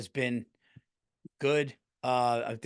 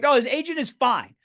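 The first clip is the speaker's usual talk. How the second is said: much higher, louder, overeager denial; mock backpedal; 11 semitones higher, 7 dB louder than usual. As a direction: flip your opinion in a hurry. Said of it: "I'll be like".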